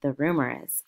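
ordinary delivery

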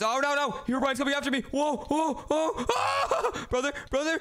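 high-pitched cries rising to a shriek; mounting panic. Real, not acted. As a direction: call for your brother while being chased. Herobrine coming after him.

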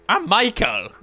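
hammed-up high "I'm Michael"; high mock voice. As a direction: squeak the name out. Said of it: "He goes".

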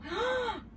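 high acted gasp; delighted gasp. Uncle sees his new hat, delighted gasp. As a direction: gasp at a lovely present.

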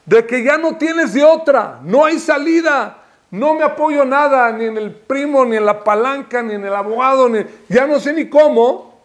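insistent cry of last resort; desperate plea. Acted. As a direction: plead with no way out left. Models desperate plea to God.

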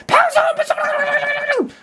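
very high, slow, loud drawn-out shout; scary wife yell. Retold or imitated. Wife yelling at husband to stop, high drawn-out shout. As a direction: bellow to shut him up.

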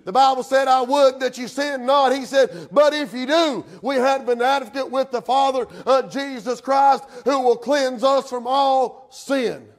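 high, loud proclamation, stretching the final words; full-force preaching. Real, not acted. Preacher proclaims Christ the advocate to the church, full-force preaching.

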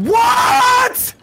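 high, loud disbelieving shout; real startled outcry; shocked what. Real, not acted. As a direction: yell in disbelief. Confused by sudden death.

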